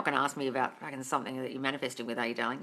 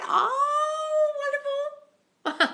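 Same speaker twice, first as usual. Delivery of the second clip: squeaky high falsetto gush; mock delight; 6 dB louder, much slower than usual. Coach mocks a viewer's news with a high falsetto gush.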